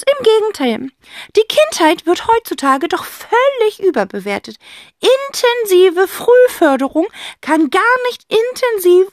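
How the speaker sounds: high, loud gushing aunt; fake-gushing chatter; babbling. A narrator's reading. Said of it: "schwafelt Tante Rotz".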